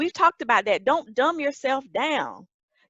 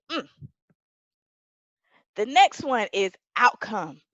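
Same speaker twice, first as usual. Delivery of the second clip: ordinary talk, nothing marked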